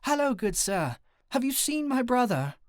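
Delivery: high, bright British lady voice; bright British accent. A narrator's reading. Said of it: "without dropping the accent".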